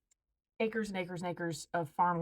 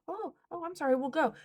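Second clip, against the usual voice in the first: mock meek apology; 8 semitones higher than usual. Mocking trespasser's sudden meekness.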